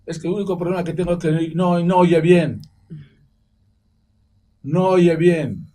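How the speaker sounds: loud, high, slowing on no oye bien; teacher defending herself, loud and slowing; emphatic complaint. Retold or imitated. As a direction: stress the bad grammar as she said it. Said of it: "la señora le contestó".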